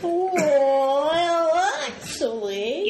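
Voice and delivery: long drawn-out nerdy pedant; hammed-up know-it-all geek; geeky voice. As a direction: stretch it way out like a mock geek. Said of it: "That's my geeky voice".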